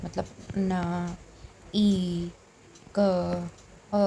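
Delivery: "न", "ई", "क", "अ" said very slowly, one by one; slow letter breakdown. Shown as a demonstration. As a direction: break it into tiny sounds. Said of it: "इसको देखिए छोटा छोटा". (बहुत धीरे वर्ण अलग करना)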